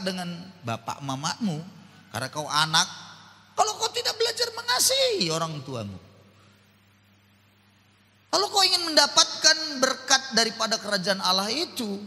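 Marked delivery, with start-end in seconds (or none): 3.5-12.0 high, loud warning to youth; fiery preaching. Acted. Preacher loudly warns young people about fighting with parents.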